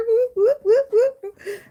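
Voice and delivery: high, loud laughing-crying whoops. Real, not acted. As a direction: cheer through laughter and tears.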